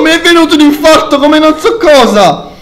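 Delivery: high, loud, still rattled; shaken and breathless. Real, not acted. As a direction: heart still racing. After jump scare.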